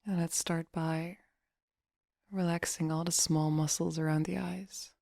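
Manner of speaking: soft, unhurried meditation voice; guided meditation. Acted. Meditation coach relaxing listeners' face and jaw, soft guiding voice. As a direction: guide them gently into relaxation, leaving long pauses.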